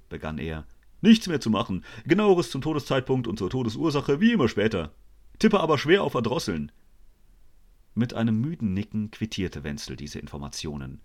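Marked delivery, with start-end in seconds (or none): 1.0-6.7 high, loud, matter-of-fact doctor; brisk police doctor. A narrator's reading. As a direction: rattle off the report briskly. Police doctor reports the death.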